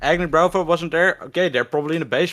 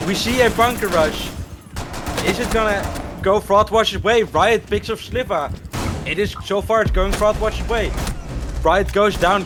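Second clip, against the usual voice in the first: fast, rising live commentary; excited play-by-play; 6 semitones higher than usual. Real, not acted. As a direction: call the action as it happens.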